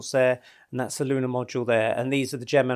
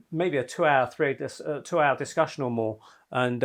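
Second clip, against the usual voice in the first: usual speaking voice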